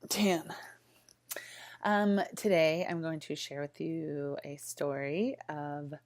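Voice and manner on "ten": breathy whispered punchline; whispered aside. Acted. Vlogger whispering the count after a chin joke.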